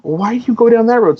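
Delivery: put-on high, curious child's question; kids asking. Father imitating his kids playing Shenmue, high and curious. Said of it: "they're like going".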